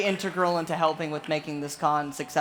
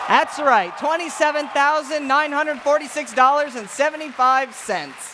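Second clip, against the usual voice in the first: higher, proud, ringing announcement; a proud, higher shout of the charity total; triumphant announcement; 7 semitones higher, 4 dB louder than usual. Real, not acted. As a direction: announce the big total to the crowd.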